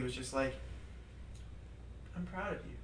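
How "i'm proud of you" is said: much softer, gentle and pleased; calm joyful. Retold or imitated. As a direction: quietly tell him you're proud. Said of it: "he just very calmly, very joyfully".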